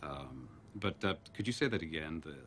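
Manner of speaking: low, soft, halting phone voice; sheepish, hesitant. Acted. First-time caller asking a stranger for fantasy, sheepish. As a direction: mumble shyly with awkward pauses.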